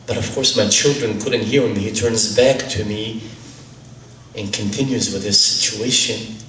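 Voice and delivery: usual speaking voice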